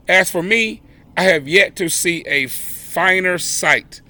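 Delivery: ordinary delivery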